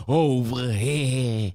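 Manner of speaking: slow, drawn-out New Yorker "over here". Retold or imitated. Host imitating his New York friends, drawn-out New York accent. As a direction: stretch it like his old school friends. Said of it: "they do say things like".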